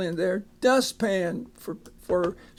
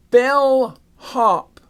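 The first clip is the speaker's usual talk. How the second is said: bellhop called out loud and clear; teacher calling out bellhop for students to repeat; loud clear demo word; 4 semitones higher, 7 dB louder than usual. Shown as a demonstration. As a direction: announce bellhop boldly for the class. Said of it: "teacher modelling word".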